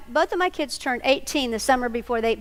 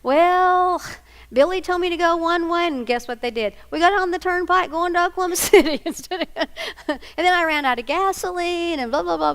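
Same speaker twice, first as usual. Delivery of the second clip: son explaining; 6 semitones higher than usual. Retold or imitated. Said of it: "He said".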